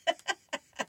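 high, short chuckle; real laugh at a joke; amused laugh. Real, not acted. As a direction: chuckle at the punchline. Laughs at the comic's punchline.

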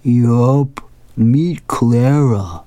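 slow cartoon husband voice; lightly voiced, drawling shark husband; Sherman. A narrator's reading. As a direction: draw the introduction out slowly in a lightly put-on voice. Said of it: "He says".

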